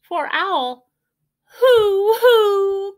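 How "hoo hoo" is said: high, loud mournful hoots; sad owl hoot. A narrator's reading. Owl rejected by the hens, sad high hoots. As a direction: hoot out the owl's sadness. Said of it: "cried Owl sadly".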